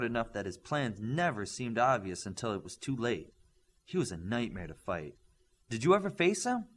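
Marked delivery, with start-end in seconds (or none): 5.7-6.7 put-on higher, louder young student voice; eager student. Student asking the coach, lightly voiced and higher. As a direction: blurt it out like a keen student.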